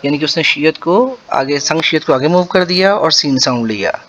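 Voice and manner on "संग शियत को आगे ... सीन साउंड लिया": normal talking voice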